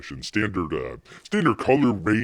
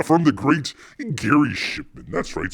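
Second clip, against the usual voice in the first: ordinary talk, nothing marked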